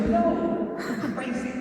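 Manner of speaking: high, laughing reply, voicing God; God laughing off a crazy offer. Preacher voicing God, laughing at Micah. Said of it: "God's like".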